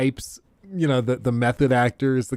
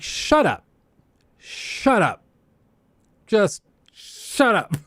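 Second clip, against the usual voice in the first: mock-exasperated, higher-pitched outburst with long pauses; 8 semitones higher, much slower than usual. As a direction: cut her off, fed up. Exasperated reply to retold actress.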